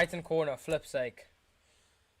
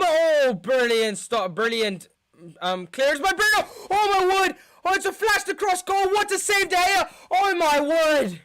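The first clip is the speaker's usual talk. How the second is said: much higher and louder, breathless awe; excited shouting; 10 semitones higher, 4 dB louder than usual. Real, not acted. Fan screaming in awe at a goal-line save. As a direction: yell in disbelief at the save.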